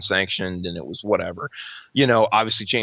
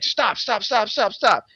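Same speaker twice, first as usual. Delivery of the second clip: high-pitched rapid-fire urging; urgent retold plea; 11 semitones higher, slower than usual. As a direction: break up a fight, pitched way up. Retold attempt to break up fight.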